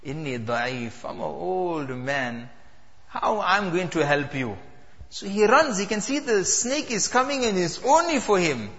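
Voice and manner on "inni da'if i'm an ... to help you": put-on a frail old man lowly refusing to help. Weak old man answers.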